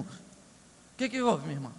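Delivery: mock concerned pastor. Pastor answers gravely.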